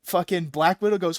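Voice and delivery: ordinary talk, nothing marked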